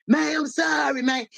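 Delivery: high, shouted mock sobbing plea; imitating crying apology. The gunman crying and apologizing, mock sobbing high. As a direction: ham up a crying man begging sorry. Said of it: "he was crying and shit".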